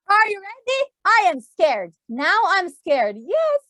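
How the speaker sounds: ordinary delivery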